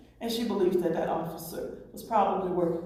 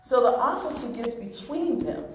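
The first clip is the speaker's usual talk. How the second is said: usual speaking voice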